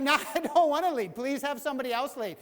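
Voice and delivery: high, loud pleading with a laugh; laughing whiny Moses. Retold or imitated. Moses pleading with God to pick someone else, laughing. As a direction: beg to be let off. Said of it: "he's like".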